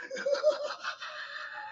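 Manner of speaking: a sudden high whoop of laughter; high shrieking laugh. Real, not acted. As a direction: shriek with laughter, then fade. Big laugh at own joke.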